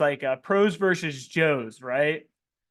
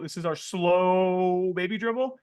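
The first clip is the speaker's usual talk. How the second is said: slow drawn-out; slower than usual. Shown as a demonstration.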